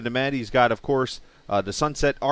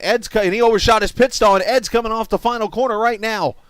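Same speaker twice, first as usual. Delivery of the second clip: excited commentary; 9 semitones higher, 6 dB louder than usual. Real, not acted. Live race call, excited.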